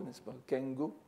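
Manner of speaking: normal talking voice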